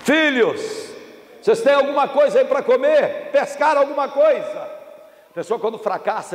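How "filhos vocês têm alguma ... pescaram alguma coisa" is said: high, projected call. Acted. Jesus calling to fishermen from the beach, calling out. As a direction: call out as if across water.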